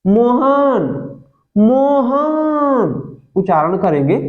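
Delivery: मोहन with its last vowel held long and slow; stretched call. Shown as a demonstration. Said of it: "देखिए मोहन".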